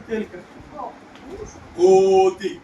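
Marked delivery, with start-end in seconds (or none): 1.8-2.5 loud, held o in కోతి; drawn-out o in కోతి. Shown as a demonstration. Teacher dictating a word to spell, long loud o in కోతి. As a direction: stretch the first vowel of కోతి loudly.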